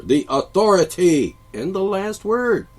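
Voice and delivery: slow, loud, high proclamation; pompous mock teacher. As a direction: draw it out as the supreme authority. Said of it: "the teacher".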